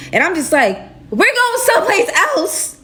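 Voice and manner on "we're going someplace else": very high, exasperated mock wail; whiny disbelief. Captive told to run once more, high whiny disbelief. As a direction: squeak it out in dismay, hammed-up. Her dismayed reaction to fleeing.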